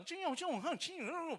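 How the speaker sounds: high, sing-song Bangka mechanics' jabber; mock Bangka chatter. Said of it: "Dia bilang".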